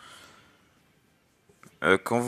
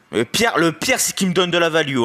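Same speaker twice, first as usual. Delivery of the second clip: high, loud frustrated rant; raging gamer; 6 semitones higher, 3 dB louder than usual. Real, not acted. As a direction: vent about the opponent. Raging about game.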